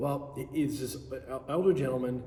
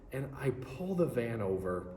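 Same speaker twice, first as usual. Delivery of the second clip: ordinary delivery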